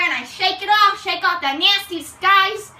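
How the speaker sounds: usual speaking voice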